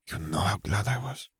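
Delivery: soft, intimate whisper; whisper in the ear. A narrator's reading. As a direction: murmur it privately into his ear. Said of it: "Fleur whispered in his ear".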